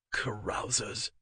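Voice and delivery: low, slow, soft mutter. A narrator's reading. Sleepy man muttering about noisy revellers. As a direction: grumble it half asleep under the breath. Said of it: "he muttered".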